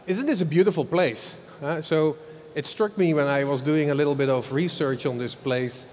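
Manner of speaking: usual speaking voice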